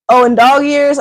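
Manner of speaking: normal talking voice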